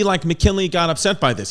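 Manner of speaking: normal talking voice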